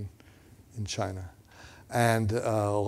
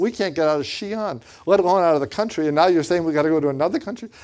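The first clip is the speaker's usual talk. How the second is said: fast, high, loud objection; incredulous retold argument, rising in pitch and speed; exasperated protest; 5 semitones higher, 4 dB louder, faster than usual. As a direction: rattle it off in disbelief.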